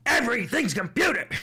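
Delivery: high, loud put-on voice; mock Trump line. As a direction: blurt it like a bragging salesman.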